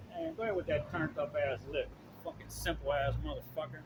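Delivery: a quiet disgusted aside; muttered aside. Real, not acted. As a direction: mutter the insult under your breath. Muttered insult aside under breath.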